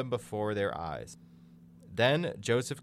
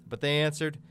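usual speaking voice

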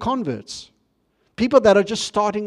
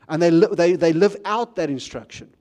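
ordinary talk, nothing marked

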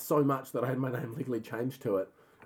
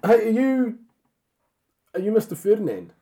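very high and loud; high excited voice; 10 semitones higher, 10 dB louder than usual. Pretend. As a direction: squeal it out, overexcited. Adam meets film boss, high.

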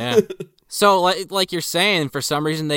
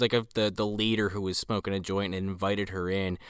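ordinary talk, nothing marked

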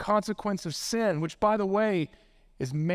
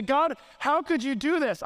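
higher-pitched retold outburst at God; angry, frustrated cry; 7 semitones higher than usual. Preacher recalls raging at the sky, angry and frustrated. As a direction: protest upward in frustration, not shouted. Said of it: "so angry and frustrated with God".

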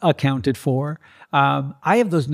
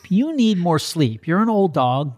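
talking to the dog; 6 semitones higher than usual. Retold or imitated. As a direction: reassure the jealous dog you'll be back. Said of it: "I just look at her and I go".

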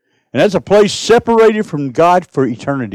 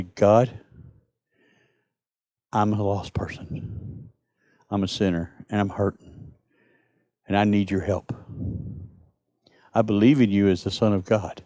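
soft, lower, humble prayer of confession; 8 semitones lower, 10 dB quieter than usual. Shown as a demonstration. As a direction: pray it gently, like a lost sinner. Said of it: "You say".

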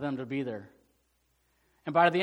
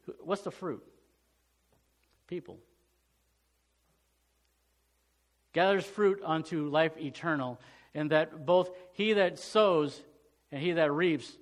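scripture reading; slower than usual. Acted. Reads scripture verse slowly.

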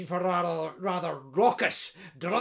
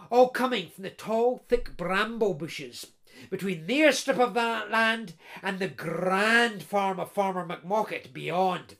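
ordinary delivery

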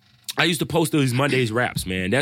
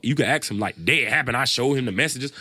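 normal talking voice

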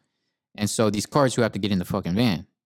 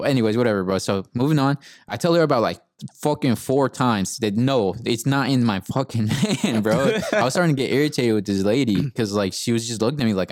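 ordinary talk, nothing marked

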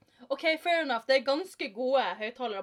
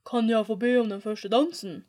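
loud, put-on gentleman's voice; exaggerated dark voice; 6 dB louder than usual. A narrator's reading. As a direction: ask for the dance in a mock-manly voice. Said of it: "sa Simone med overdrevet mørk stemme".

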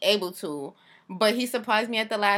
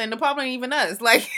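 ordinary talk

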